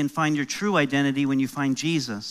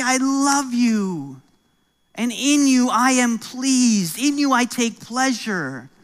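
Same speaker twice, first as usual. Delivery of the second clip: tender father; 8 semitones higher, 3 dB louder, slower than usual. Retold or imitated. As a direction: linger on each word like holding a baby. Father speaking to newborn children.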